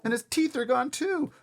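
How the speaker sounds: put-on high, loud boyish announcement; alarmed discovery. A narrator's reading. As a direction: announce the shocking find. Boy reports missing teeth.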